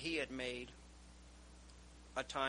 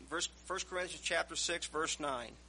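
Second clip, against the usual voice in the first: normal talking voice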